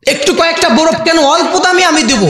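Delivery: high, imploring call for help; plaintive begging cry. Acted. Ice seller begging someone to step closer and help, plaintive begging cry. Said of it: "করুণ কান্নার সুরে চিৎকার করছে".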